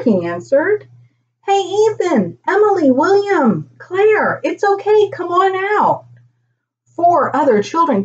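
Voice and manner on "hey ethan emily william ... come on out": high-pitched coaxing call to friends. A narrator's reading. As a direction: call each name high, coax them out. Boy calls hidden children over.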